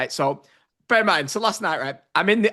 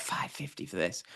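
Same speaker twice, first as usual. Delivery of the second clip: hushed disbelieving aside to himself; whispered outrage over a pricey bottle of water; muttered under breath; 10 semitones lower, 15 dB quieter than usual. Retold or imitated. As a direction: grumble it almost silently, shocked at the price.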